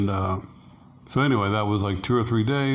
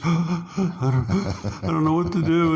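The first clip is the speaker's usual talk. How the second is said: mock sobbing gasp; 7 semitones higher, slower than usual. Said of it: "she starts crying".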